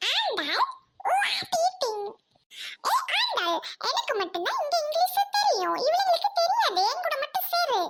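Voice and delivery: very high put-on squeaky voice; show-off friend. Shows off English, claims friend. (உச்சக் குரலில் பெருமை)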